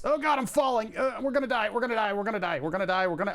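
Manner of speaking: high, hammy panic as you fall; mock panic. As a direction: yelp it like a cartoon doom. Falling in game, panicking.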